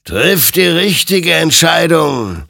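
high, loud, slow warning from the creature; admonishing. Acted. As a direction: warn him gravely, drawing it out. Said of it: "ermahnte ihn sein Gegenüber".

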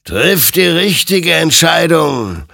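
loud, high, drawn-out warning; slow, ringing exhortation; stern admonition. A narrator's reading. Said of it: "ermahnte ihn sein Gegenüber".

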